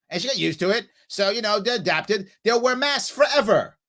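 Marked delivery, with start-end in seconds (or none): 2.4-3.8 a snide mock of the governor. Mocking the governor's words to viewers, scornful. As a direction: parrot her, smug and dismissive. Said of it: "That's what she just said".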